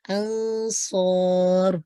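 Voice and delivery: slow "अंसौर" with a heavy saad and alif; "अंसौर" drawn out, heavy; "अंसौर" recited with a heavy alif. Shown as a demonstration. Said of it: "अलिफ भी heavy हो रहा है".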